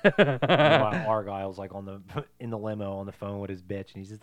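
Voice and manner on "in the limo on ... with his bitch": ordinary talk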